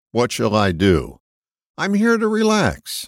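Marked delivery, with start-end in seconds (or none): 1.8-3.1 lifted much higher, relaxed; light example. Shown as a demonstration. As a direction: toss it off high and easy. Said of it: "listing purposes".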